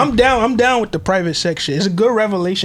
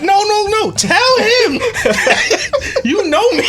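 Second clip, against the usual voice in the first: high and louder, laughing between words; 4 dB louder, slower than usual. Real, not acted. Begging his friend to back him up, laughing. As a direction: plead through the giggles.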